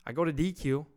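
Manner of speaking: ordinary talk, nothing marked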